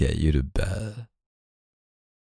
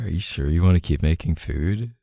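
ordinary delivery

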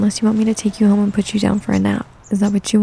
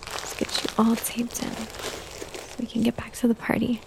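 much softer, slow whisper; sleepy whisper; 11 dB quieter than usual. Acted. Mother hushing her sleepy toddler, slow whisper. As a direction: whisper slowly so the sleepy child stays calm.

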